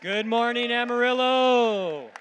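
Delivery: booming arena announcer greeting; game announcer voice. Acted. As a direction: call it out to the whole arena. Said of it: "our game announcer".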